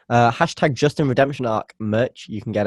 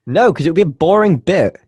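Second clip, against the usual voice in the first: loud protest; 7 semitones higher, 6 dB louder than usual. Real, not acted. Arguing back about the bit.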